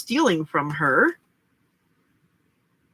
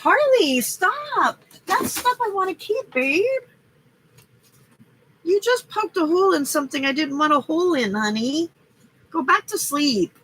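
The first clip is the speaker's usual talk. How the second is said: high sing-song scolding of the cat; pet voice; 10 semitones higher than usual. Real, not acted. Owner scolding her cat Harley, high pet voice.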